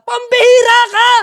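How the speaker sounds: loud, high exasperated yell; preacher shouting in mock exasperation at a grown believer. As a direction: blow up at them.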